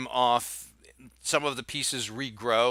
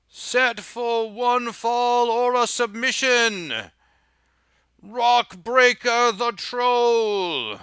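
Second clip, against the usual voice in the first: slow, higher, louder ring announcer; booming announcer; 10 semitones higher, 6 dB louder, slower than usual. Acted. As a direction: stretch every word out like a magically amplified arena call. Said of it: "his voice booms out magically".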